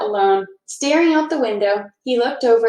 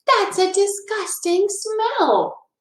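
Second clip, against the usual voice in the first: higher, slow disgusted holler; stretched, high mocking yuck; 10 semitones higher, slower than usual. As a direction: cry out in exaggerated disgust. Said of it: "Ralph hollered".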